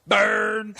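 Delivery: loud, high-pitched jeer; laughing, drawn-out taunt; gleeful heckle. Real, not acted. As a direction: crow it over a friend's put-down. Heckling a co-host's jab.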